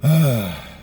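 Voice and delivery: heavy audible sigh. Real, not acted. Veteran who can no longer jump, heavy real sigh. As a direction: let out a big breath. Pauses after his injuries.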